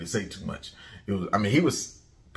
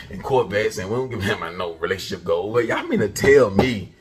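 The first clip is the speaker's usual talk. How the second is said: usual speaking voice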